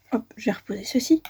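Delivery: quick unvoiced whisper; whispered aside. Real, not acted. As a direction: murmur it under your breath. Aside while putting tool down.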